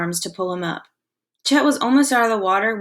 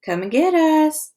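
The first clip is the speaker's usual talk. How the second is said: slow, high teasing call; called through cupped hands, slow and teasing; mock calling; 9 semitones higher, 4 dB louder, slower than usual. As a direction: taunt the shark like calling a pet. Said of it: "Monty called through cupped hands".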